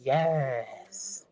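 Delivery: low, long drawn-out meditative yes. Retold or imitated. Quoting the funny affirmation guy, low meditative tone. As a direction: imitate a dead-serious meditation guide, low and lingering. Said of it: "it was in a meditative tone".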